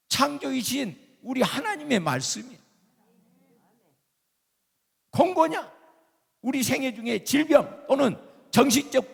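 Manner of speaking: loud, high-pitched sermon delivery; preach. Real, not acted. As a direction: proclaim it forcefully to the congregation. Preaches at full force. (힘주어 외치는 설교)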